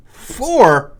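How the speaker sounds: four? in disbelief, rising doubt; incredulous repeat. Acted. Host doubting his own score, incredulous. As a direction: question the score, surprised. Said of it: "how many fists would this get you".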